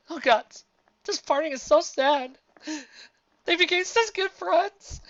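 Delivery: high, slow comic wail; hammed-up weepy lament; mock tearful. A narrator's reading. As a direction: wail it slowly, overcome by the farewell. Mock weeping at a parting.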